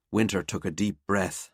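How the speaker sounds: plain reading voice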